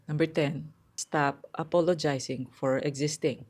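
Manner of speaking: usual speaking voice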